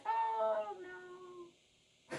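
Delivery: high then faint, slow mock uncertainty; unsure whiny voice. Reader imitating an unsure viewer, mock whine. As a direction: fade into a small doubtful whine. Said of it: "everyone has that voice".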